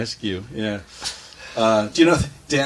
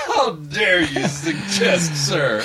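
laughing through fake offence, slow; mock indignation; 4 semitones higher, much slower than usual. Host mocking the caller with a laughing, offended voice. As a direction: laugh while playing scandalised.

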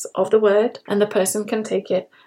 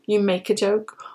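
normal talking voice

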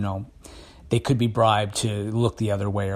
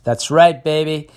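cocky and pleased with himself, louder; 5 semitones higher, 6 dB louder than usual. Real, not acted. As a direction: brag playfully about his accent. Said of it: "Rolling my R's?".

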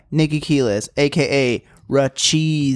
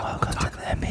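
soft mock ASMR whisper; 8 dB quieter, much faster than usual. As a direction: breathe the words out unvoiced. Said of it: "Why are we whispering?".

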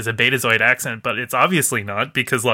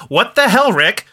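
indignant mock outburst, high and louder on the key words; exasperated complaint; 10 semitones higher, 3 dB louder than usual. Actress confronting her producer about her accent, mock exasperated. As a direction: demand an answer in mock outrage.

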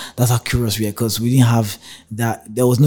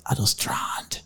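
whisper; 9 dB quieter, much slower than usual. Retold or imitated. Confiding he is stranded.

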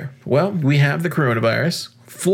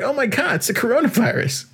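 mock panic; 9 semitones higher than usual. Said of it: "People were scared. They're like".

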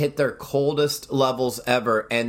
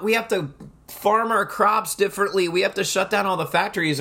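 higher, hammed-up panicky voice of a climate worrier; host mocking 1930s alarmists, mock panic; 6 semitones higher than usual. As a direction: lightly parody the doomsayers. Mocking alarmists after freaking out.

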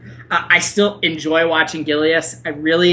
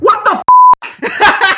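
loud, high, stretched-out outrage; can't believe what he just heard; outraged disbelief; 9 dB louder, much slower than usual. Real, not acted. Outrage at betting line.

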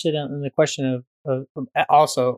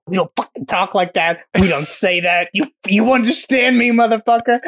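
mock angry New Yorker; 6 semitones higher, 3 dB louder than usual. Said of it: "grabs him aggressively".

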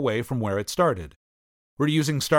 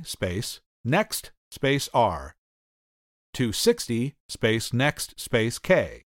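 slow, spelled-out dictation; dictating; slower than usual. Shown as a demonstration. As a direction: read code out character by character. Reading code lines aloud.

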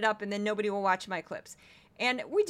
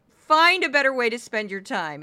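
loud, exasperated telling-off; irritated scolding; 9 dB louder than usual. Real, not acted. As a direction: scold them loudly. Scolding the annoying account.

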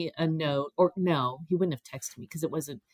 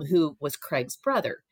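normal talking voice

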